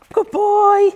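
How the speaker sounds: sing-song praise to a good dog. Real, not acted. Trainer praising her dog Sid, high praise voice.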